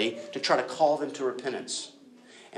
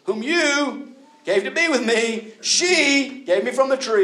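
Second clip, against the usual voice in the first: blaming Adam; 13 semitones higher, 7 dB louder, slower than usual. Retold or imitated. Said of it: "look how Adam does it".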